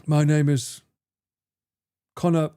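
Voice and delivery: mock posh British accent; Connor. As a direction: imitate a very proper posh Englishman. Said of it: "But Connor still has that".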